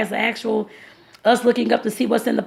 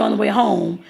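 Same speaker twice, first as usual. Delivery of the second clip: ordinary talk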